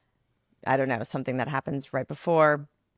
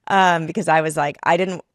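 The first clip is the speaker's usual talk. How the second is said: normal talking voice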